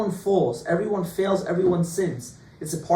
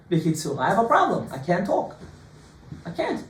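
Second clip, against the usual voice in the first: normal talking voice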